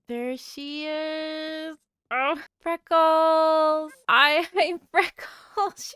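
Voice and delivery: high, slow, squealing joy over a cute villager; gushing delight. Real, not acted. Gamer finally meeting villager Freckles, squealing with delight.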